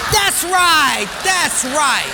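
loud, high, hearty confirmation; triumphant approval. Acted. Personnel guy cheers the Portuguese guy's answer, loud and triumphant.

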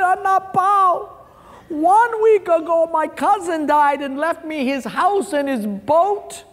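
high, slow fake-tearful wail; hammed-up blubbering, words stretched out; mock crying. Crying man continues his story to the friend, mock wailing. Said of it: "this guy who was crying".

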